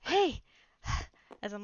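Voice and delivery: normal talking voice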